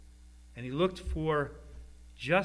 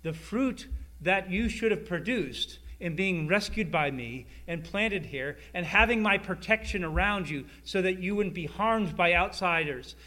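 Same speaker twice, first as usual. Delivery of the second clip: ordinary delivery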